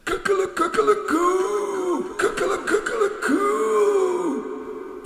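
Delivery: high, slow crowing; rooster crow. A narrator's reading. Cockerels show off their crowing, high and drawn out. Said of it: "would crow as if they had been the first".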